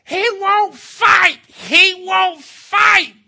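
put-on very high, loud, drawn-out childish wail; tantrum wail. Preacher imitating a woman's complaint about her husband, tantrum wail. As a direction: bawl each word slowly like a kid in a fit. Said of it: "like she's throwing a fit, like a kid".

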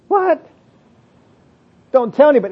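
high-pitched incredulous "What?"; acted astonishment, pitched well up; mock disbelief. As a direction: imitate someone balking at an order. Acting people rejecting Jesus's order.

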